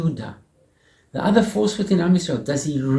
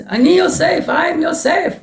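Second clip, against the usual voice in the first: much higher, shouted at full voice; crying out; 11 semitones higher, 3 dB louder than usual. Acted. Yosef reveals himself to his brothers, crying out at the top of his voice. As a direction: burst out with it, overwhelmed. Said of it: "And he starts to cry, the top of his voice".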